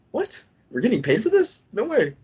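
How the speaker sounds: can't believe the good news; excited disbelief. Retold or imitated. Recruit learning he gets paid, excited disbelief.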